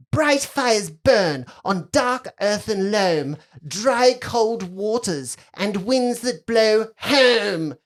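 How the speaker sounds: very slow, grand chanting recital; incantation. Acted. Girl on the stairs casting spell, solemn and drawn-out. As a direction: draw out each word like a spell.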